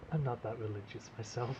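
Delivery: quoted line. Retold or imitated. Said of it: "It's a Desert Bus reference".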